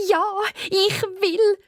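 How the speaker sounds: high, happy exclamation; joyful cry. Acted. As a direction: call out with delight. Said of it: "ruft sie".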